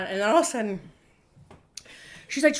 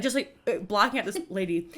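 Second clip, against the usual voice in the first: ordinary delivery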